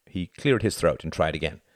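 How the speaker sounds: plain reading voice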